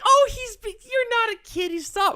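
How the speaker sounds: high, flustered mom voice. Retold or imitated. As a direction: play her as a high-pitched, flustered mother. Mother's flustered reply, acted.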